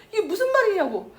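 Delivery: high and tearful, leaning hard on 무슨; tearful protest. Retold or imitated. Mother to husband, high tearful demand. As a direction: demand 이게 무슨 말이냐고 through tears.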